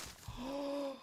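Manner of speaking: long, high intake of breath; soft drawn-in gasp of amazement; awed gasp. Real, not acted. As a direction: gasp at a wonderful gift. Opening gift box.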